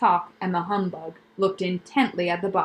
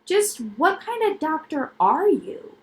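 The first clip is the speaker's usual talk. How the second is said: put-on slow, wary boy's question; suspicious; 8 semitones higher than usual. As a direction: ask it with narrowed distrust.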